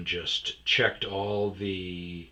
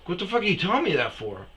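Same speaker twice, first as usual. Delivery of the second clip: fast, higher, louder incredulous snap; rapid mock outrage; retold exasperation; 11 semitones higher, 7 dB louder, faster than usual. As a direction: fire it off in disbelief. Retold angry reply.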